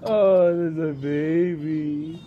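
normal talking voice

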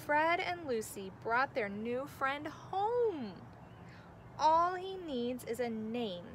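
gentle storybook narration; reading to children. A narrator's reading. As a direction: read it like bedtime story time. Reads picture book aloud.

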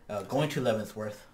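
usual speaking voice

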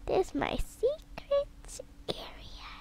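soft whispered reveal; secret whisper. Acted. To viewers, revealing her hidden area in a whisper.

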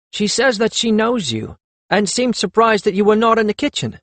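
high-pitched, disdainful servant; sniffy servant. A narrator's reading. Servant telling Perry about her visitor, sniffy and high. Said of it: "The servant sniffed".

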